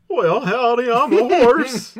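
very high, loud, slow howdy horse; hee-haw it like a cartoon horse; cowboy horse voice. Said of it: "let me do my impression".

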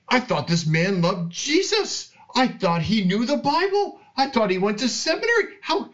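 high-pitched, stunned inner protest; retold disbelief. Retold inner shock at friend's view.

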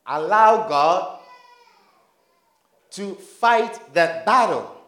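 drawn out and weighty, every word leaned on; slow emphatic repeat. Shown as a demonstration. Preacher drives the phrase home to the church, slow and emphatic. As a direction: let it sink in slowly.

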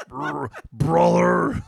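slow, loud drawn-out bro call; mock gym bro. Joking about getting ripped, loud slow gym-bro call. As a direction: stretch it like a meathead.

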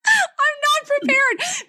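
high, slow, whiny mock wail; mock distress. Real, not acted. Host put on the spot, wailing in mock distress. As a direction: play up the panic at being put on the spot. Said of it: "Now I'm so stressed out".

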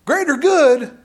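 half-hearted shout, breaking into a laugh; wife in movie scolding husband, mock shouted; mock scream. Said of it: "she screams out".

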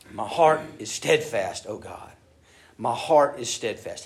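solemn emphatic reading; emphatic repetition. Shown as a demonstration. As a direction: lean on "heart" each time, slow and strong. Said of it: "He repeats it for emphasis".

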